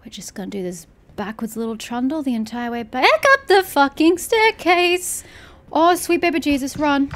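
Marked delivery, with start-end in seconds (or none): 2.8-7.1 high, loud frantic flight; panicked. Real, not acted. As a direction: flee up the stairs in panic. Fleeing the monster.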